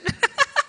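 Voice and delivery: short, high bright laugh. Real, not acted. Emcee laughing after admitting she is moved. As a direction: let a giddy laugh out.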